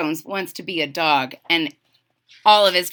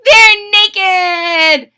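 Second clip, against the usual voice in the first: high, loud, gleeful squeal; giggly little sister; 11 semitones higher, 11 dB louder than usual. A narrator's reading. Jilly Boo delivers her silly correction, gleeful squeal.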